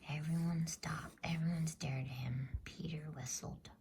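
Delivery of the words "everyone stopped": ordinary delivery, nothing marked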